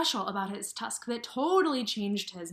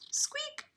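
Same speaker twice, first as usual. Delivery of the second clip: tiny high squeaky mouse noise; mouse squeak. Acted. Teacher voicing the mouse for the class, high squeak.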